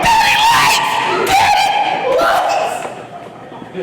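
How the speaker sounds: put-on very high, drawn-out toddler shriek; ecstatic toddler. Son seeing his shoes light up, ecstatic mock toddler shout. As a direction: shout like a little boy amazed. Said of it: "he's just ecstatic".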